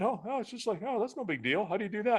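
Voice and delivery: high, lilting mimicry of effortless ease; mock nonchalance. Parent mimicking Braden picking things up with no effort, mock-casual.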